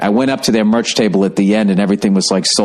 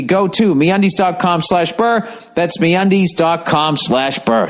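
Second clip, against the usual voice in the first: higher, brighter sponsor pitch; ad read; 7 semitones higher than usual. Acted. Host reading the underwear ad to listeners, upbeat. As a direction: sell it like a podcast ad.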